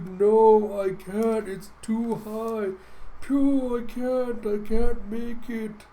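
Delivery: high, drawn-out desperate wail; panicked struggling character. Acted. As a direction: wail as if losing the climb. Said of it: "he just can't do it".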